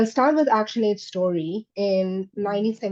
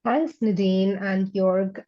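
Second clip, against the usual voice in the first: ordinary talk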